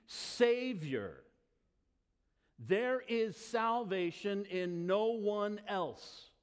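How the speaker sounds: slow, emphatic sermon preaching. Acted. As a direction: drive home the one and only Savior. Preaching the confession.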